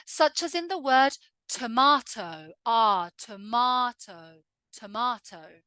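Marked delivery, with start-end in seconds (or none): 1.5-5.6 "tomato" as "to-mah-to"; long open "ah" in the middle of "tomato"; British "tomato" with the AH sound; Southern English AH. Shown as a demonstration. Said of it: "in a Southern English accent".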